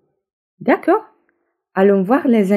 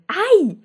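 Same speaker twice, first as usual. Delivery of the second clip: high yelped ouch; sharp cry of pain; 12 semitones higher, 4 dB louder than usual. Acted. Badger hit by the running rabbit, yelp. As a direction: yelp as if bumped hard. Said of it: "s'écrie le blaireau grincheux".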